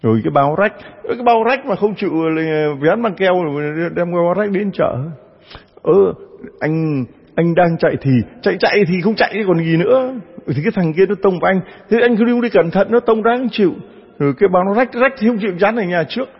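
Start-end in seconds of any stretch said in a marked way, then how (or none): 5.8-16.3 wife tearing into her husband; wife berating her husband over the torn bag, pitched up; nagging wife. Acted.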